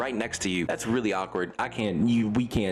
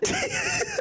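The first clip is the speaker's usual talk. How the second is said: squeaky burst of laughter; high laugh. Real, not acted. Laughs at own joke.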